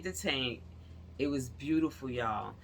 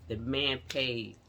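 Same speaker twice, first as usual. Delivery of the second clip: ordinary delivery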